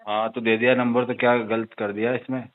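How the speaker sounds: low, quiet, unbothered retort; dismissive tone. Real, not acted. Borrower's friend to caller questioning his number being given, dismissive. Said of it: "किस tone में बात कर रहे हो आप?".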